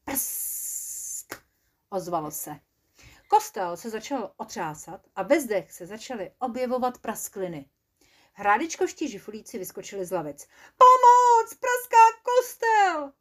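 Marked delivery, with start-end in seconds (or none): 0.0-1.4 breathy voiceless prsk; a crackle sound in the dark; whispered crack. Acted. 10.8-13.1 loud, much higher, slow shout for help; frightened goblins yelling help; panicked cry. Acted.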